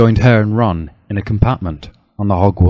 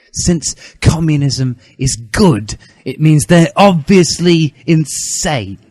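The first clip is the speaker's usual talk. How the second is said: raised, slow indignant schoolboy voice; a lightly voiced righteous teen, drawn out; earnest young Harry; 6 semitones higher, 4 dB louder, slower than usual. Harry explaining.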